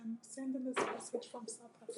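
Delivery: barely audible coaching; quiet prompt. Real, not acted. Parent softly prompting child's words.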